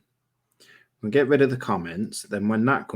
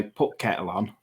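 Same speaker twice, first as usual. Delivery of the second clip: Yorkshire "Put kettle on." with the "the" dropped, said a little softer; Yorkshire accent; 4 dB quieter than usual. Shown as a demonstration. Showing a listener how Yorkshire people ask for tea, in a Yorkshire accent. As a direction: drop the article the way Yorkshire folk do. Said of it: "I'll turn and say".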